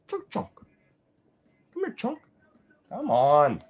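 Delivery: high-pitched coaxing call; calling a pet. Real, not acted. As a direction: coax the pet over in a small high voice.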